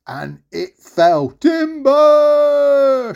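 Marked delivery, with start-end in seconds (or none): 1.3-3.1 long, high shout of timber; lumberjack call. Acted. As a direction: holler a drawn-out falling-tree warning. The beanstalk falls.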